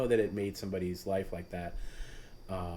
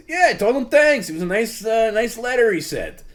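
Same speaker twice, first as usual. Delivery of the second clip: very high, loud squeaky mock of a clueless friend; mocking Perry; 14 semitones higher, 12 dB louder than usual. Host mocking Perry's answer, squeaky and clueless. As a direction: play him as dim and cheerfully oblivious. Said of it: "mimicking Perry's reply".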